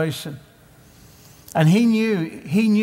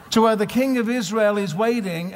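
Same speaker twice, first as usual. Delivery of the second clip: usual speaking voice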